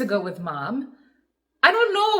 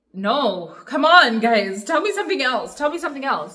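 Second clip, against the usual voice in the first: desperate plea; 6 semitones higher, 8 dB louder than usual. Retold or imitated. Denial on hearing of dad's death.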